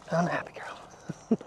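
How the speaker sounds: soft breathy whisper for the dog, slow; dog's voice. Pretend. Owner voicing his happy basset in a whisper. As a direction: whisper the dog's line slowly and gently. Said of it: "She goes".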